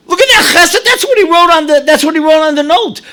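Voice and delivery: preacher thrilled by the kindness; rapt amazement. Real, not acted. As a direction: proclaim it with glowing wonder. Marvelling at the kindness.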